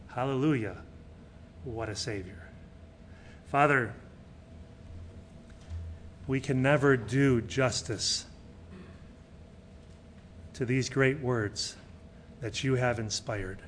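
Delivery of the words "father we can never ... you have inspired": soft, lower, unhurried prayer voice; closing prayer. Real, not acted. Pastor praying to God after the sermon, low and soft. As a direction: speak to the Father humbly.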